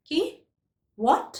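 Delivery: a short, sharp, startled "What?"; surprised what. Shown as a demonstration. Teacher showing students a surprised "What?". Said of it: "একটা আশ্চর্য হয়ে আমরা বলি".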